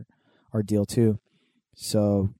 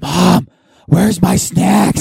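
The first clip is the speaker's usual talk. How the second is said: put-on loud slow kid yell, higher; yelling across the room; 8 semitones higher, 12 dB louder, much slower than usual. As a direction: shout it slowly across the theater. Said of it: "movie voice".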